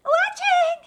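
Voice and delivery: put-on high, loud child voice; squeaky kid greeting. Child voice in game.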